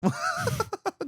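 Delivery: real high burst of laughter; helpless high laugh at a joke; high-pitched laugh. Real, not acted.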